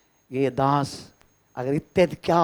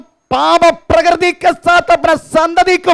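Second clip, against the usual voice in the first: higher and louder, hammering each phrase to the church; full-force preaching; 13 semitones higher, 9 dB louder than usual. Acted. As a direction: testify it out to the congregation, loud and high. (ऊँची और ज़ोरदार आवाज़ में प्रचार)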